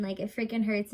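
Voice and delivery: ordinary delivery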